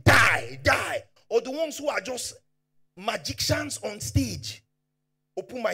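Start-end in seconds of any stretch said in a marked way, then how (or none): 0.0-1.0 loud shouted die; mock doom preacher. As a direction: bark it like a fear preacher. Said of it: "the ones who are preaching".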